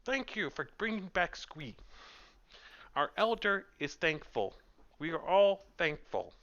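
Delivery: high, small squeaky goblin, polite and grateful; a young goblin guard bowing and thanking you in a high squeak. Acted. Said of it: "a little squeaky voice".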